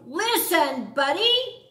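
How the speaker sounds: loud, high yell of "Listen, Buddy!". A narrator's reading. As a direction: parents shout to get him to listen. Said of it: "His parents tried yelling".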